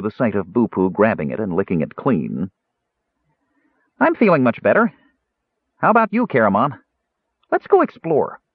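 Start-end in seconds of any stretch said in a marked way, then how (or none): 4.0-8.4 eager kender. Acted.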